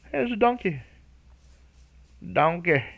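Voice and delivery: high, loud bad Irish accent on the donkey; a hammed-up Irish accent, pitched well up; terrible Irish accent. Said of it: "my terrible Irish accent".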